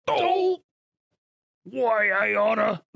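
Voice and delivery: high yelp into a drawn-out growly threat; Homer Simpson. Acted. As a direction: imitate an angry cartoon dad strangling someone. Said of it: "doing your Homer voice".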